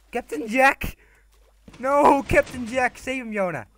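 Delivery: high, loud dramatic cry; mock panic. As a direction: wail in hammed-up alarm. Mock panic over a drowning character.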